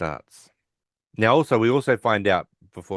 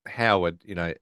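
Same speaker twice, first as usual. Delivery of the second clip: ordinary delivery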